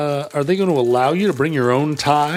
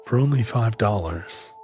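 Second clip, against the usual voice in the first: seductive voice; 4 semitones lower than usual. Pretend. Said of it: "most seductive internet voice".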